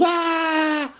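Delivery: high piercing shriek of "ah"; mother's scream. Acted. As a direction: let out a desperate mother's cry. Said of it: "pegando gritos". (grito agudo y desesperado de una madre)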